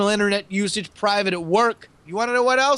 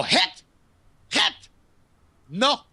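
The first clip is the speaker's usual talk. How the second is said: sharp high yelps; 5 semitones higher, much slower than usual. Acted. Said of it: "They go".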